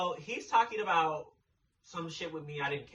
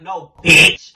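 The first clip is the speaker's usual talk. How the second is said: loud snap of "No, bitch"; retold sharp refusal; 21 dB louder than usual. Snapping back at Car over the brownie, loud. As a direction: shut him down hard. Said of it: "So I was like".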